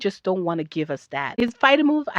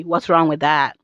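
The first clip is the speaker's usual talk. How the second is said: normal talking voice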